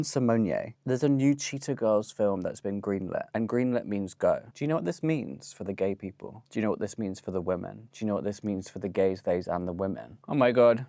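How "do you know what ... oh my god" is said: ordinary delivery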